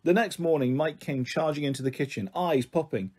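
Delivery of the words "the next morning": ordinary delivery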